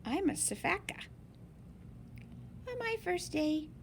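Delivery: plain reading voice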